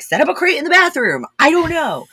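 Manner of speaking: mock-exasperated, high-voiced reply; exasperated retort. Acted argument over kennel space.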